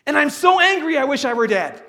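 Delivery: loud and high, biting reply; angry shout. Retold or imitated. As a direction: fire back in rage. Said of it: "Jonah's response".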